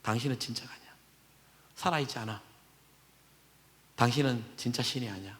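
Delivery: low, hushed, disillusioned words; dropping to a near hush, heart turning away; bitter quiet rejection. Retold or imitated. Believer quietly rejecting God, low and hushed. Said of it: "이렇게 되는 거죠".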